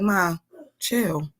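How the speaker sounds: hushed, soothing daughter impression; soft calming voice. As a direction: softly tell mom to calm down. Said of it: "Like, so soft, just".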